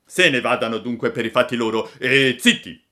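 high, bold puppet voice; Pinocchio boasting. Acted. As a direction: play the cocky little puppet talking big.

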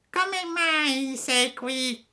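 very high, slow cat voice; the cat parrots the fox in a thin high voice; echoing cat. Acted. Ripeté il gatto. (il gatto ripete, voce acutissima e lenta)

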